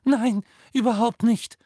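high, loud squeak; high-pitched girl's reply. A narrator's reading. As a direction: squeak it out nervously. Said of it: "quiekte Hermine".

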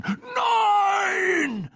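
very high, long despairing cry; desperate shout. A narrator's reading. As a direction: scream it out in horror. Cry as Riddle is thrown.

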